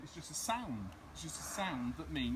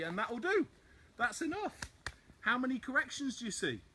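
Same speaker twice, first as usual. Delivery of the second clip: excited wrap-up; 6 semitones higher, 5 dB louder than usual. Real, not acted. Triumphant end of demonstration.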